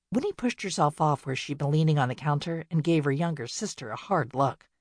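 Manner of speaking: plain reading voice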